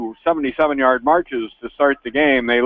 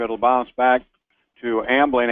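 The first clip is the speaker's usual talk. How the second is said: normal talking voice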